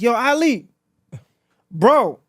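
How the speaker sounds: loud, high retold alarm call; alarmed caller. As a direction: imitate a friend urgently warning of a scam. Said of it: "call me like".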